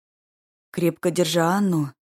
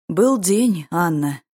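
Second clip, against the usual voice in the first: ordinary delivery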